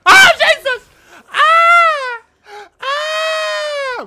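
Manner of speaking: full-panic scream with a crying edge; screaming in terror. Real, not acted. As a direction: scream for your life. Monster attack, screams.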